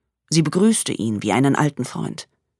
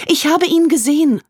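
frightened; 12 semitones higher, 6 dB louder than usual. Acted. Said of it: "sagte sie".

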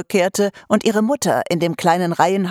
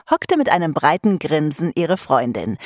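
ordinary delivery

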